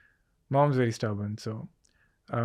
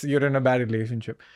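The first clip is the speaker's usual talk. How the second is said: ordinary talk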